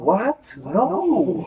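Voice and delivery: high, loud feigned innocence; unconvincing liar. Retold or imitated. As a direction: imitate a bad liar's shocked denial. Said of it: "It sounded like he was lying".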